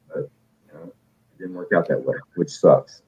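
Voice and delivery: ordinary talk, nothing marked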